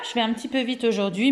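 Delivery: ordinary talk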